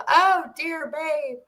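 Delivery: high, slow, syrupy sarcastic; mock sweet husband. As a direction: drag out the fake sweetness. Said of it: "he goes".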